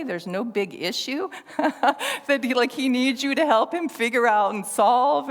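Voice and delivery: can't believe it; a friend marvelling at James; incredulous friend. Retold or imitated. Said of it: "It's like".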